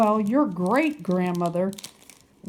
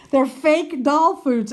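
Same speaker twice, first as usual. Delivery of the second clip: laughing, higher-pitched protest; amused disbelief; 5 semitones higher, 4 dB louder than usual. Real, not acted. Vlogger amused at craving toy food, laughing and higher. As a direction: burst out laughing into the words. Laughs at mouth watering over fakes.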